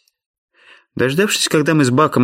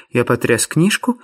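plain reading voice

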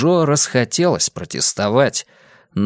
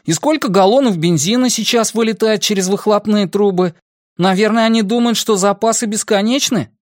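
put-on high-pitched teenage grumbling; Бенни; 9 semitones higher than usual. A narrator's reading. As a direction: complain to your friends in a raised, youthful pitch. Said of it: "подал голос Бенни". (возмущённый высокий подростковый голос)

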